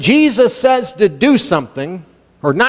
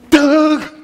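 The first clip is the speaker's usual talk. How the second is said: high, sudden incredulous cry; exasperated outburst; 8 semitones higher than usual. Real, not acted. Preacher reacting to late givers, exasperated outburst. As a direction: burst out high, then laugh.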